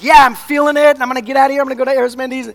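high, loud burst of hype; mock fired-up sermon zeal. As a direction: shout it like you're pumped up at a rally. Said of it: "all fired up during a sermon type thing".

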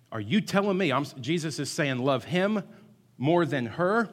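hammed-up outrage from a young husband; man in Sunday school objecting, angrily; angry retort. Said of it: "he got, he got mad".

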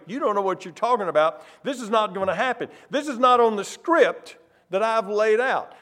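fast, rushed objection; hurried Peter rant. Acted. Peter setting Jesus straight, fast and rushed. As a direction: rattle it off to set him straight.

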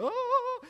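sudden high-pitched "oh"; mock revival gasp. Preacher mimicking heart attack victims jolted by caffeine, mock revival gasp. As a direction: jolt back to life with a high "oh".

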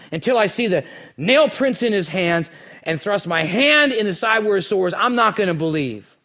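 preaching at full force. Acted. Pastor telling the resurrection story to the church, fervent preaching. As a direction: drive the story forward like a revival preacher.

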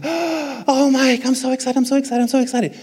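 high-pitched imitation of an excited wife; hammed-up giddy falsetto; gushing excitement. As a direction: squeal it, rushing the repeats. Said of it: "Tanya's so excited. She's like".

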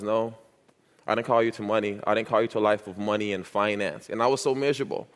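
usual speaking voice

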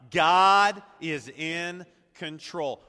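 booming, slow declaration; leading congregational repeat. Shown as a demonstration. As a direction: hold each word, GOD loudest. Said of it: "One more time".

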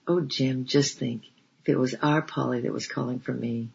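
ordinary delivery, nothing marked

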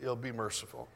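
ordinary talk, nothing marked

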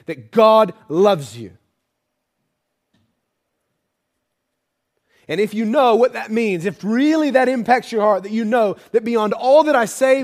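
loud, high, building preaching; full-force preaching. Acted. Preacher pressing the listeners on God's love, loud and high. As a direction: drive it home to the congregation, loud.

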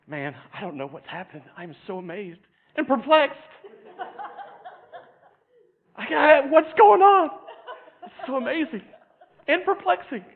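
starts hushed, then climbs high and loud into laughing disbelief; a baffled onlooker whose voice shoots up as he laughs; amazed bystander. Acted. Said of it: "I can just see myself standing there".

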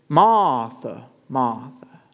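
imitated Southern Cajun name-calling; Cajun Jesus. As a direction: call the name in a mock Cajun accent, R dropped. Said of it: "Southern Louisianan Cajun".

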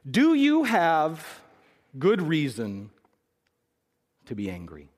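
drawn out, each word weighed slowly; slow gentle question. Acted. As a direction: let the question land, word by word. God's quiet question to Jonah.

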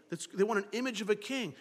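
ordinary delivery

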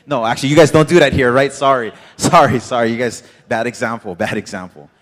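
loud, quick, laughing sorry; laughing loud apology. Real, not acted. Preacher apologizing to the crowd for a bad example, laughing loudly. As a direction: blurt out the apology through laughter.

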